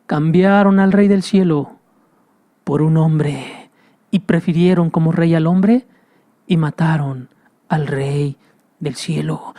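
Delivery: ordinary talk, nothing marked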